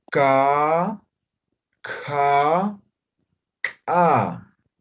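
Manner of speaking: plain ka, aspirated ka, explosive ka; ka, ka blown, ka popped; Aymara three-level demo. Shown as a demonstration. Teacher modelling Aymara consonant series, loud and deliberate. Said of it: "Los tres niveles de sonido".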